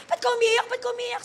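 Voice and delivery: high, hurried questioning; retold fretting. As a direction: fret at someone in tears. Retold fretting over the crying.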